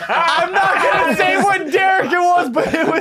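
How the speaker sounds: high, loud and slow through laughter; bursting out laughing while talking; real laughter breaking the words; laughing shout. Real, not acted. Cracking up mid-line.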